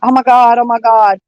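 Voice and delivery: retelling a freak-out in the van, half-mock panic; retold freak-out. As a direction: relive the panic of finding the van dead. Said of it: "I'm freaking out".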